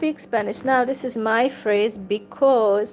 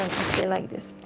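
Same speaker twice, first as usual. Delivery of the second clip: usual speaking voice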